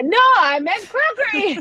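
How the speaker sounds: high laughing correction. Real, not acted.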